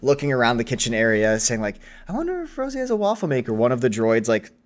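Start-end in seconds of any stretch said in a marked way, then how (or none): 2.1-3.5 high, quick little droid voice. Acted. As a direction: chirp it out fast like a curious droid.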